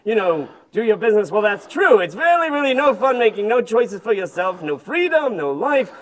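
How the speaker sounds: put-on tired, complaining old camel voice; grumpy old camel. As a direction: moan about aching back and feet.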